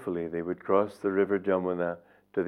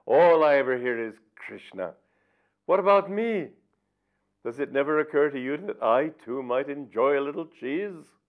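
higher, louder, jealous mock complaint; mock indignation; 6 semitones higher, 3 dB louder than usual. Vyasa teasing the gopis for cheese, mock-indignant higher voice. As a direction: whine it like a sulking sage, half in fun. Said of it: "shouted Vyasa as if in anger".

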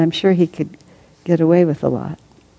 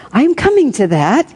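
higher, louder assurance; reassuring philosopher; 8 semitones higher, 4 dB louder than usual. A narrator's reading. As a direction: placate her in a raised voice. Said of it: "he assured her".

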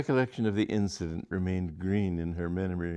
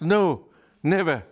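indignant; 9 semitones higher, 8 dB louder than usual. Acted. Charlotte refusing.

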